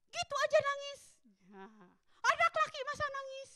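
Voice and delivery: high, sneering put-down; a mother mocking her crying son, high and sneering; scornful mockery. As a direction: belittle the crying boy. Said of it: "pengen ngomong begitu".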